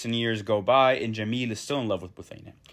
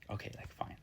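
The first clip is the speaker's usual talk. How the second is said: shy, breathless whisper; blushing girl giving in to the poet, whispered; bashful whisper; 19 dB quieter than usual. Retold or imitated. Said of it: "Buthaina started blushing".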